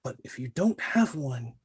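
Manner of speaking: warm and floaty. Shown as a demonstration. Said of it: "very warmly, very floaty almost".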